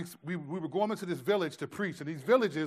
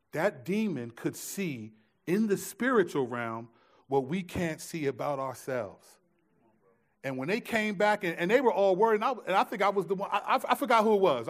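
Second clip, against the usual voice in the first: ordinary talk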